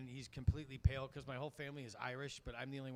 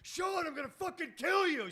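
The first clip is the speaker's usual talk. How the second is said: much higher, louder angry yell; furious sister; 7 dB louder than usual. Retold or imitated. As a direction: blow up at your brother, high and loud.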